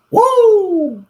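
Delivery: loud high whoop of surprise; a sudden, high "woo!" at the noise; startled whoop. Real, not acted. Startled by the dumpster lid blowing, loud high whoop.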